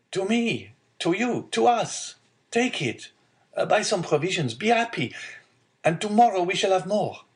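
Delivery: put-on high-pitched, excited young man; joyful young son. Son sharing money with father, joyful and excited. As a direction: bubble over with generosity, pitch well up.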